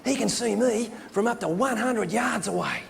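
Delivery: slow, swooping broad Australian wildlife presenter; drawn-out, intense hushed-excitement nature host; Steve Irwin. Acted. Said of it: "he just looked down the camera and went".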